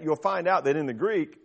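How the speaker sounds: ordinary talk, nothing marked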